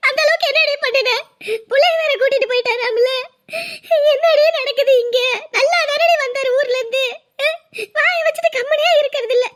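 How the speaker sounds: high, crying reproach; tearful scolding. Acted. Mother blaming daughter for the broken marriage, tearful scolding. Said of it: "எதுக்கு அழுதுகிட்டு இருக்க".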